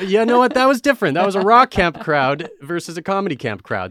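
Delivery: high, loud defensive comeback; mock-indignant protest. Real, not acted. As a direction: protest loudly over the teasing, pitch jumping up. Teased, defends past silent reception.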